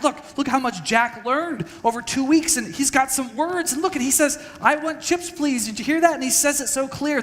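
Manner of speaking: high-pitched, excited proud parent. Retold or imitated. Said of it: "We would get so excited".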